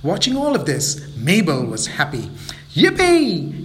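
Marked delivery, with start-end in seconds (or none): 2.8-3.7 high, loud yippee; gleeful cheer. Acted. Mabel finding the sack, loud and gleeful. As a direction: burst out with joy.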